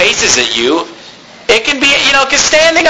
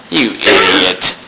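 low, slow sneering contempt; lightly imitated disdainful sneer; mock scornful glare; 9 semitones lower, slower than usual. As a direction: voice a visitor's silent scorn, low and drawn out. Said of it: "making a face like".

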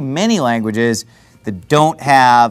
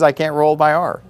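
ordinary talk, nothing marked

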